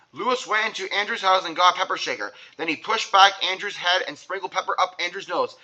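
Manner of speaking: plain reading voice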